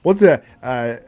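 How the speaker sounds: playful Milos catchphrase; Milos accent. Retold or imitated. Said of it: "I love doing the accent".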